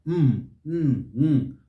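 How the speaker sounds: "Mm" hummed through the nose; nasalized sounds. Shown as a demonstration. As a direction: sustain a nasal "Mm", one at a time. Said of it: "Nasalized sounds".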